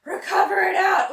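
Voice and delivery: loud, breathless fitness cue; coach shouting. Real, not acted. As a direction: call it out over the music. Coach shouting cues mid-set.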